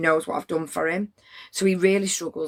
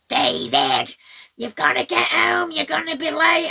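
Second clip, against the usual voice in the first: a higher, louder shrieking mother calling her son in; screeching fishwife; 10 semitones higher, 4 dB louder than usual. Retold or imitated. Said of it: "she's screaming like an old fishwife".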